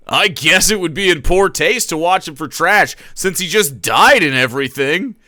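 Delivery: nervous streamer voice. Acted. Jake speaking to chat.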